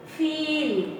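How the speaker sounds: "Feel" with a lengthened ee; long vowel in feel. Shown as a demonstration. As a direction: hold the ee of "Feel", then let it fall. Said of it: "Where is the stress".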